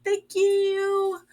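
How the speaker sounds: high, squealing mock gratitude; imitating a gushing friend; gushing thanks. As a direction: gush like an overjoyed guest. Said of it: "She's like".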